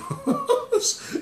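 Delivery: a high, short burst of laughter; laughing at a viewer's joke; real laugh. Real, not acted.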